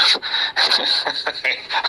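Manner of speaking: short unvoiced giggle; can't hold a straight face, laughing; breathy laugh. Real, not acted. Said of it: "I can't even say that with a straight face".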